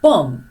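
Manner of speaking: بام with a silent final b; bomb said bam. Shown as a demonstration. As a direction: drop the last b, just بام. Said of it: "اون بی آخر رو خیلی لازم نیست بگیم بامب". (بام بدون ب آخر)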